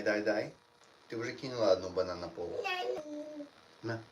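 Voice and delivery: ordinary delivery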